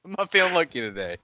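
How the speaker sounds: high, loud defensive protest; high defensive whine. Real, not acted. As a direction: plead it was bad luck. Defending bad dice roll.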